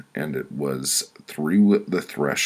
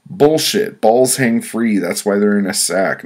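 heated argument; 6 semitones higher, 9 dB louder than usual. A narrator's reading. Narrator argues with wife loudly.

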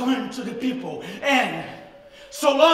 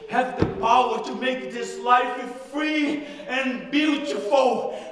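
ordinary talk, nothing marked

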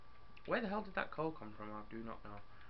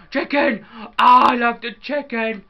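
squealing with delight, high and loud; excited; 10 semitones higher, 19 dB louder, slower than usual. Real, not acted. Gamer suddenly thrilled at a chicken, excited high yell. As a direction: burst out in glee over a chicken. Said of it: "I just got excited about chicken".

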